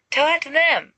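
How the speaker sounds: high, sharp yelp of alarm. Acted. Sirius yelping back at Remus, high alarmed yelp. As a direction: yelp it out, startled. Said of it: "Sirius yelps".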